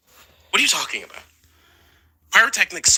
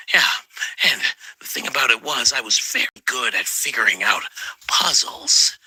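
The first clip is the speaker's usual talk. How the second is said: ordinary talk, nothing marked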